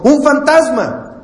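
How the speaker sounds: a high, slow, hammed-up cry of fright; frightened cry. Disciples in the boat seeing a ghost, mock frightened cry. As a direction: act out the disciples' scared outburst. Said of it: "y gritaron de miedo".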